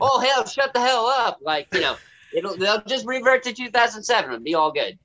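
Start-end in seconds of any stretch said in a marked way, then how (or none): 0.0-1.4 higher-pitched sarcastic hail; mock herald turned dismissive. Co-hosts answering the king's herald, mock-ceremonial dismissal. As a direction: start as fanfare, then wave it away.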